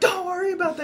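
very high, defensive mock yell; yelling back. Naked friend brushing off question, defensive yelling. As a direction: squawk it defensively. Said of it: "while you're both yelling".

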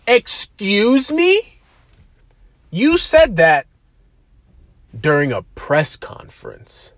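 high, loud, drawn-out disbelief; mock incredulous. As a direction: act appalled, stretching it out high and loud. Mock disbelief at retold quote.

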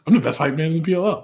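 high and fast, bursting with hype; hype man. Retold or imitated. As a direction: brag it out like a pumped-up teammate. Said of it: "He goes".

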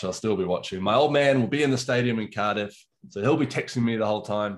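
normal talking voice

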